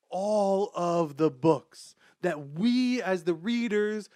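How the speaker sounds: slow, loud, weighty emphasis; emphatic build. Real, not acted. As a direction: lean on every word. Emphatic emotional reveal.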